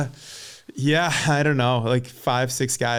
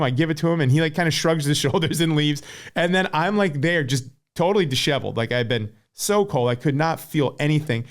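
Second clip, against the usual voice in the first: usual speaking voice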